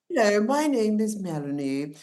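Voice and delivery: mock your younger self's very posh English; hammed-up posh introduction; posh English accent. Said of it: "I sound so flipping posh".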